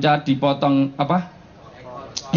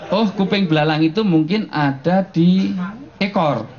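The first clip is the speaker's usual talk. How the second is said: usual speaking voice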